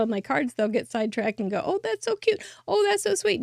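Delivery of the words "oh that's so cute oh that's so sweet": mock gush from onlookers, pitched way up; imagined gushing. Onlookers distracted by her cards, gushing high. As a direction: play strangers won over by the cards, squealing.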